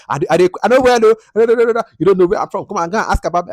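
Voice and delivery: high, fast boastful character voice; imitating Demilade. Reviewer mimics Demilade bragging, high and fast. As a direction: brag fast and high like a show-off. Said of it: "When she was visiting, like".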